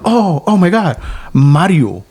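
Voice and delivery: excited exclamation. Retold or imitated. Friend excited at hearing the name.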